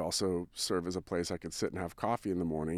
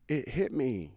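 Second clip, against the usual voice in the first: usual speaking voice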